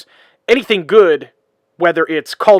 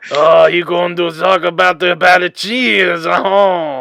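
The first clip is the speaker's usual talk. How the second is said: mock French accent; 2 semitones higher, 4 dB louder than usual. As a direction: ham up a Québécois drawl. Mocking the cheese accent.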